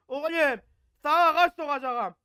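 loud, high angry threat to the doll. Real, not acted. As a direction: bark the threat at the cursed toy. Menace le jouet maudit.

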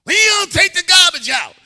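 loud, high nagging shout; harsh demanding tone. Acted. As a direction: holler it like a fed-up accusation. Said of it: "It's the tone in which you act".

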